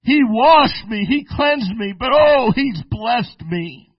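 loud, high, ringing preacher's cry; preaching at full force. Acted. Preacher rousing his congregation, loud ecstatic preaching. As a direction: whoop it up like a revival preacher.

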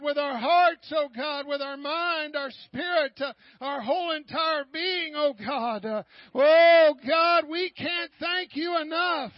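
impassioned praise, swelling on key words; fervent prayer. Real, not acted. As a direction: cry out thanks to God at full force. Leads fervent prayer of thanks.

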